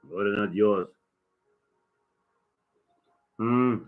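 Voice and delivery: usual speaking voice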